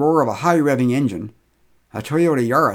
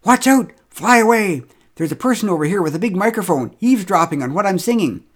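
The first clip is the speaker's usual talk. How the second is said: high, loud alarmed bird voice; loud, high panicked birdsong warning; bird warning call; 7 semitones higher, 4 dB louder than usual. Retold or imitated. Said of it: "recordings of birds saying".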